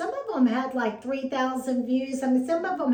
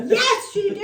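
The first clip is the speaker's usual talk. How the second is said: high, louder imitated retort; mock annoyed insistence; 9 semitones higher, 5 dB louder than usual. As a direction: play an exasperated friend insisting. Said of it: "She's mad at me".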